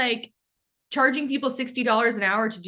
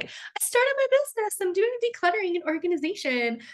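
much higher, put-on voice of her eager younger self; mocking past self; 12 semitones higher than usual. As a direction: parody your naive beginner self. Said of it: "laughing about my journey".

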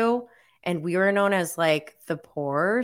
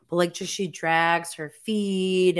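ordinary delivery

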